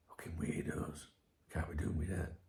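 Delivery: muttered whisper under the breath; whispered aside. Real, not acted. Vlogger muttering about the messagers, whispered scorn. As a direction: hiss it to yourself in disgust.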